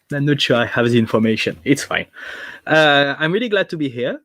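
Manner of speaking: ordinary talk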